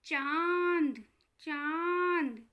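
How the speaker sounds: चांद drawn out slowly with the vowel through the nose; slow teaching demo of चांद with nasal vowel; nasal chandrabindu demo. Shown as a demonstration. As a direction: stretch चांद, letting the aa ring nasally. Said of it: "आप बोल कर देखिए".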